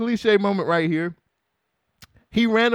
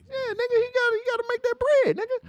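squeaky-high, rapid retort; comic insistence; 3 dB quieter, faster than usual. Real, not acted. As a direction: push it way up high and hurry it. Comic insistence he must earn money.

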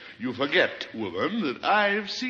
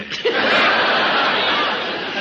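high breathy burst of laughter; airy laugh at his own quip; 6 dB louder than usual. Real, not acted. Mocking Tallulah's boast, a high breathy laugh. [name] laughing at double jeopardy.

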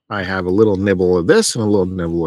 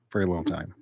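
much softer muttered echo; muttered aside; 4 semitones lower, 11 dB quieter, much faster than usual. Acted. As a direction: repeat it under your breath. Muttered echo of own line.

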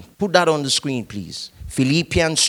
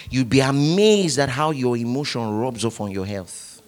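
normal talking voice

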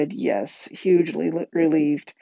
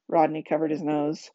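usual speaking voice

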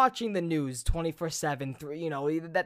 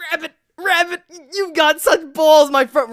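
loud mock shout; 8 semitones higher, 9 dB louder than usual. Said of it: "I'm like".

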